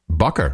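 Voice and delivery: "Bucker" rhyming with "trucker", not like Baker; Dutch surname pronunciation. Shown as a demonstration. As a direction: say "Bucker" with a short "buck" first syllable. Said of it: "I would say".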